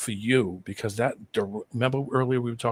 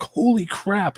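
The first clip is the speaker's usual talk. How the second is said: much higher, imitating astonishment; retold amazement; 11 semitones higher, 3 dB louder, slower than usual. Retelling a host's first look at the trucks, amazed. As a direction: exclaim it higher, as he did.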